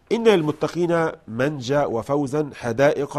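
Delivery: usual speaking voice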